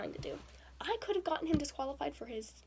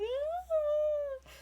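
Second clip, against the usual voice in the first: high, loud mock wail; retold distressed cry; anguished wail; 8 dB louder than usual. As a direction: let out a sob-like cry. Crying response to friends.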